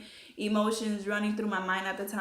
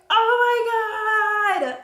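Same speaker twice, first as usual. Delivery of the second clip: shocked exclaim; 13 semitones higher, 11 dB louder, much slower than usual. Retold or imitated. Sees two lines on test.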